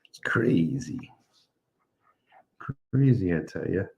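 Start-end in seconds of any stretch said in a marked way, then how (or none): 0.1-1.1 whisper. Real, not acted. Muttered aside after praising actor.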